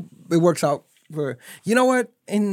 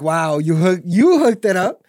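higher, louder hyped-up thanks; mock gratitude; 4 semitones higher, 5 dB louder, slower than usual. Man acting out thanks for saved money, mock gratitude. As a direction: act wowed, stretching "hooked it up".

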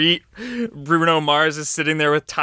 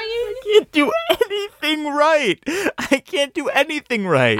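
sobbing wail; 14 semitones higher than usual. Acted. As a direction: bawl it out, high and helpless. Guide breaks down crying.

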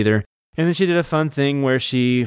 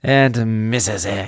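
slow mock French accent; much slower than usual. Co-host shouting out a donor, drawn-out French accent. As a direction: draw it out in hammy French. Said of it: "In a French accent".

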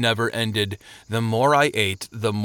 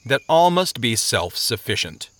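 plain reading voice

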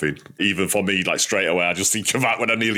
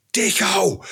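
retold shout; 10 semitones higher than usual. Golfer warning his playing partner of a wayward shot, retold shout. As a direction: call out a name in alarm, retold. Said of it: "And I shouts".

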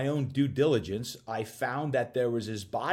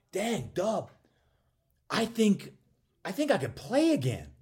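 much higher, bright burst of joy; excited disbelief; 8 semitones higher than usual. Retold or imitated. Telling Dub after the session he could play again, elated. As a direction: sound amazed that the pain is gone.

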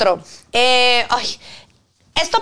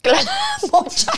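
high, giggling echo; laughing. Real, not acted. Cohost laughing at her friend's phrase, giggling. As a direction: laugh the word out.

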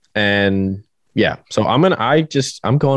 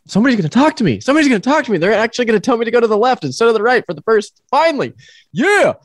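much higher, excited inner voice; eager anticipation; 12 semitones higher, faster than usual. Retold or imitated. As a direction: replay the hopeful buzz in the helmet. Self-quote while waiting at track.

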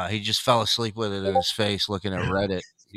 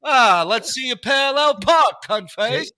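sneering examiner, much higher and louder; mock examiner; 11 semitones higher, 7 dB louder than usual. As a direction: jeer at the student as a nasty tester. Mocking the driving examiner.

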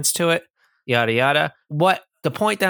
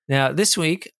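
ordinary delivery

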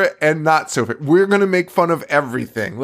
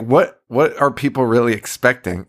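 ordinary delivery

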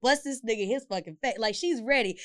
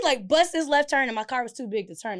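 ordinary delivery

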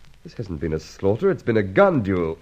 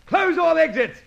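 raised, urgent orders to his men; commanding shout. Acted. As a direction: bark the orders across the hall. Inspector orders men to chase.

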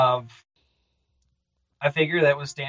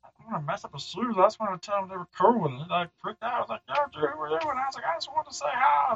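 higher, whiny self-excusing monster; defensive creature; 7 semitones higher, faster than usual. Acted. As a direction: justify yourself, a bit hurt. Creature retells meeting kid.

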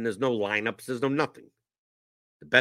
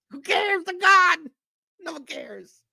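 high, loud dismissive yelling; exasperated shouting; 6 dB louder, slower than usual. Real, not acted. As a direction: yell that nobody cares. Escalating dismissive rant.

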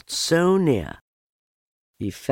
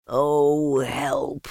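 long-drawn, higher cry for help; trapped bear's cry; 5 semitones higher than usual. A narrator's reading. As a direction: wail it out slowly from inside a jar. Trapped Pooh calls for help.

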